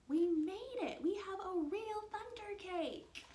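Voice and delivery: high, loud triumphant cry; jubilant, drawn-out shout of joy; excited cheer. Acted. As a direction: celebrate like a thrilled child. Child's triumph in story.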